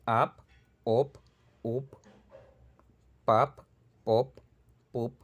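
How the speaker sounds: Ap, op, up, pap, pop, pup, each with a crisp unreleased final p; final P drill. Shown as a demonstration. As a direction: clip every final p short and firm. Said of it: "va a sonar como P al final de la palabra".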